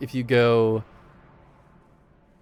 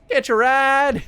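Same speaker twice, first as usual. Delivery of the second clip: Scooter impression; 12 semitones higher, 5 dB louder than usual. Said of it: "Sound like Scooter from Borderlands".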